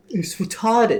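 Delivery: goofy high-pitched imitation; Brian. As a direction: put on a goofy accent, high. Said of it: "He said it in his goofy accent".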